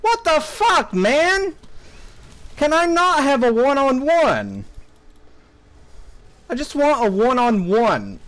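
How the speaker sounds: high, exasperated outburst; angry. Real, not acted. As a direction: protest in real frustration. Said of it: "how angry I got for the very last match".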